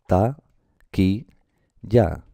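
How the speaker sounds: "ta, qui, ya" as three separate beats; syllable by syllable. Shown as a demonstration. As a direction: split "ta, qui, ya" with a pause after each syllable. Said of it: "son tres golpes de voz".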